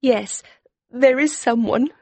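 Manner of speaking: put-on very high young woman's voice, close to tears; trembling voice. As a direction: answer tremulously, about to cry. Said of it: "she said in a trembling voice".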